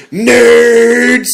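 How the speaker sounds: high, loud mock yell of the catchphrase. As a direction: bellow it at the nerds, hammed-up. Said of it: "doing their new catchphrase of".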